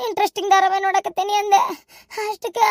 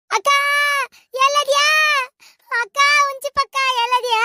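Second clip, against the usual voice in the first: panicked calling out; 6 semitones higher than usual. Acted. Younger sister searches for akka, panicked calling out. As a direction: call out in a high, alarmed wail.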